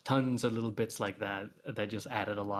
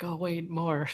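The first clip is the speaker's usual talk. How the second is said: higher, laughing mock disappointment; wistful wanting more; 8 semitones higher, slower than usual. Author reacting to the chapter ending, playfully disappointed. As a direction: playfully let down, breaking into a laugh. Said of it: "I got a little bit sad".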